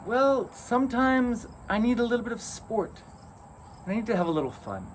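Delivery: high playful fairy voice. Acted.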